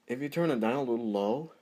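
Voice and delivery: ordinary talk, nothing marked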